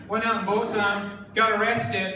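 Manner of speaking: usual speaking voice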